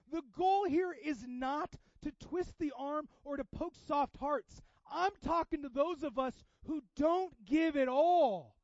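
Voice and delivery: loud, high, stretched-out emphasis; impassioned pulpit delivery; full-force preaching. Real, not acted. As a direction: preach it slowly at full volume.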